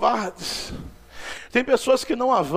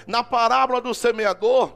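usual speaking voice

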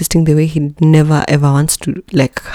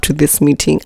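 ordinary talk, nothing marked

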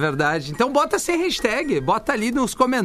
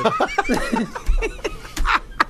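burst of laughter. Real, not acted. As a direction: laugh hard at your own joke. Laughs after his own punchline.

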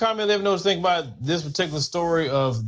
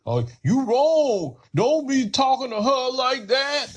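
much higher, slow, prissy scolding voice; mock scolding ally; 9 semitones higher, slower than usual. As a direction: scold sweetly and slowly, like a preachy bystander. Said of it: "you have some of 'em that be out here".

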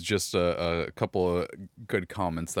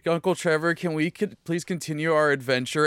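high, pleading child impression; imitated ten-year-old; 7 semitones higher than usual. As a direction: plead lightly like an eager young nephew. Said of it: "my ten-year-old comes up to me".

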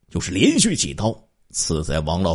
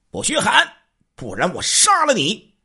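high, loud thug's threat; 14 semitones higher than usual. Acted. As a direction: bark the threat at the captive family. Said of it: "这瘦子大声说道". (高声厉喝的威胁)

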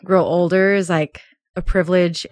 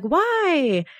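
mock puzzled why; 8 semitones higher than usual. [name]'s impression.

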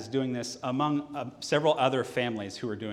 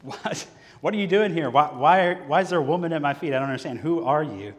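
sudden loud, high, fast alarm; jolted awake and baffled, blurting it out; alarmed shout of confusion at a stranger; startled Boaz; 4 semitones higher, 7 dB louder than usual. Acted. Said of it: "and he says".

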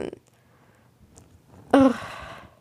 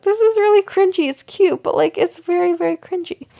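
mock cringe; 6 semitones higher than usual. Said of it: "I was like".

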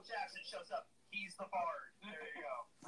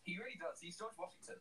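ordinary talk

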